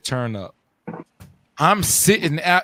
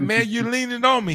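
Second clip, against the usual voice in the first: high-pitched indignant complaint; raised, accusing quote; confrontational; 8 semitones higher than usual. Retold or imitated. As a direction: act out picking a fight. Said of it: "that's when the vitriol".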